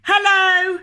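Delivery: loud high shouted greeting. Acted. Loudland people shouting hello in the street, loud. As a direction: bellow hello across the street. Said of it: "they'd shout".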